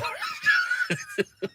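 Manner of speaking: sudden high burst of laughter. Real, not acted. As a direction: crack up at the headline. Laughs at own headline joke.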